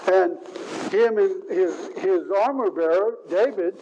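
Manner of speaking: usual speaking voice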